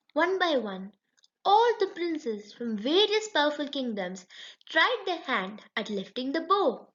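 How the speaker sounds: usual speaking voice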